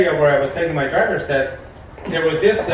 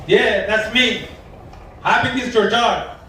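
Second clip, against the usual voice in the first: put-on light Spanish-accented gardener, higher; imitating a Spanish-accented voice on the phone; 5 semitones higher than usual. Gardener answering the phone in a mock Spanish accent.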